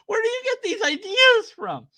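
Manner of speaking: high-pitched baffled question; incredulous. Real, not acted. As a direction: ask in genuine disbelief. Disbelief at the clip's claim.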